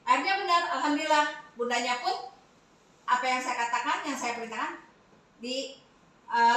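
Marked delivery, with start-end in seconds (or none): none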